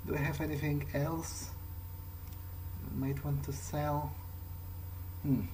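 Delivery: normal talking voice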